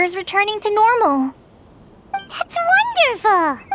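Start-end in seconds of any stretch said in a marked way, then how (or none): 2.1-3.7 high and drawn-out, slow with joy; joyful relief. Acted. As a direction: let the good news lift you up. Hears child is better.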